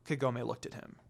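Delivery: ordinary delivery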